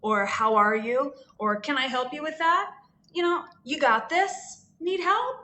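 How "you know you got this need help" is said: shop clerk voice. Retold or imitated. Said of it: "different ways that we say hello".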